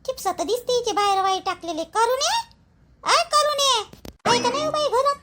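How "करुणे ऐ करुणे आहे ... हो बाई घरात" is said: calling out. Acted. Calling neighbour again from outside.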